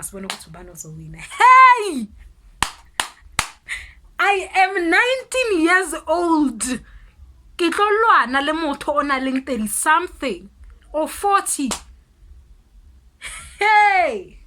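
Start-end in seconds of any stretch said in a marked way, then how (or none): 1.2-11.7 high, loud indignant yelling, slow on the age; you cannot believe it; shouting in disbelief at a grown woman; outraged shout. Retold or imitated. Outraged at fighting an adult. 13.6-14.4 high, loud exclamation; indignant shout; outraged yell. Retold or imitated.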